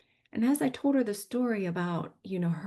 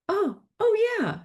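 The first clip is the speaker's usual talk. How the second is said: mock-eager, much higher and louder; eager excitement; 7 dB louder than usual. Psychic suddenly recognizing someone, eager high voice.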